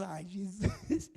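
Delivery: soft, slow, meek little sigh; hushed and drawn out, mock meek. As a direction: play the tamed hothead going gentle. Meek John near Jesus.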